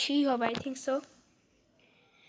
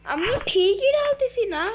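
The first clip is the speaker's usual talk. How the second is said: high, raised-pitch indignant complaint; exasperated protest; 9 semitones higher, 4 dB louder than usual. Real, not acted. Player insisting to the game they did it right, exasperated. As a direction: protest in real frustration, pitch soaring.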